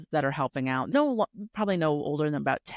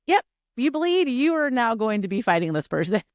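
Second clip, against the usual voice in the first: chirpy announcement; 6 semitones higher, 4 dB louder than usual. Retold or imitated. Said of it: "They're like".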